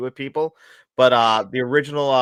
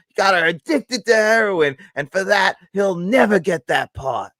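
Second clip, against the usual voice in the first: mock movie-producer tirade; 6 semitones higher, 4 dB louder than usual. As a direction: rant deliberately, drawing out each grievance. Acting out a Godfather producer's tirade.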